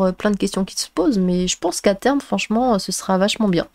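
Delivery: normal talking voice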